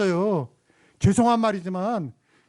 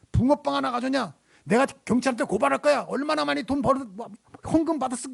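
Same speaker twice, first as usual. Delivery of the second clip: higher-pitched accusing rant, retold; mock angry threat; 7 semitones higher than usual. Homeless man threatens to report the pastor, mock angry. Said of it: "이렇게 막 화를 내더라는 거죠".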